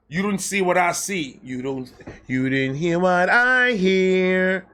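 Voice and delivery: normal talking voice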